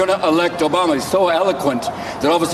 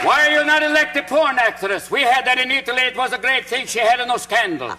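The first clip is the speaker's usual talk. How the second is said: higher, put-on Italian accent; 5 semitones higher than usual. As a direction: sing it out in a hammy Italian accent.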